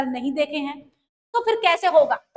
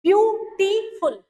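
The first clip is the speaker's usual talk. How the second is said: slow syllable demo; 3 semitones higher, slower than usual. Shown as a demonstration. Teacher to class, counting syllables: beautiful said slowly. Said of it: "आप देख सकते हैं कि इसमें कितने syllable थे".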